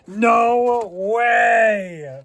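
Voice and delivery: loud, high amazed shout; real joyful shout; excited shout. Real, not acted. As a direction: yell it out in disbelief. Finds a GMT 800.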